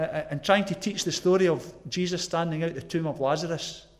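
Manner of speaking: ordinary delivery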